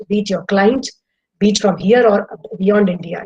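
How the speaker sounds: ordinary talk, nothing marked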